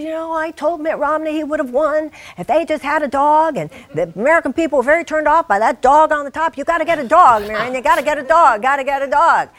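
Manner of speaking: high, loud, pushy woman voice; insistent party guest. Retold or imitated. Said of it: "She said".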